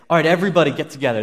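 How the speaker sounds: acted soldier summoning everyone over; calling out. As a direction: round up the troops, fast and loud.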